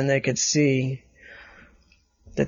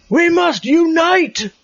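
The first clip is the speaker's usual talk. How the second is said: higher, louder, slow proclamation to the crowd; loud rallying call; 4 dB louder, slower than usual. A narrator's reading. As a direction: call out to unite them. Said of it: "in a loud voice".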